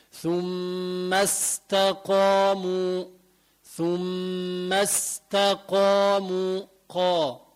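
very slow recitation, استقاموا with a full "qoo"; heavy ق. Shown as a demonstration. Teacher reciting for students, heavy ق in استقاموا. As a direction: thicken and back the ق of استقاموا. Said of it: "فخمة".

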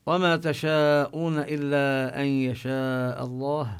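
chanted Quran verse, drawn out; Quran recitation. Acted. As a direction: recite the verse slowly and carefully.

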